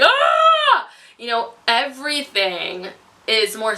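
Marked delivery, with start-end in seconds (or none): 0.0-0.9 sharp, high yelp of pain; retold pain yelp. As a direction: cry out as the exam hurts. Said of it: "I was literally like".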